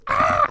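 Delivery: a high, loud shriek of fright; retold scream. Woman on a dark street shrieks at the passer-by, high startled scream. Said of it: "she screams my".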